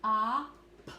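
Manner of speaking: "ah, p" segmented sound by sound; teacher segmenting crop. Shown as a demonstration. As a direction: break the word into sounds. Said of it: "we hear that short O sound".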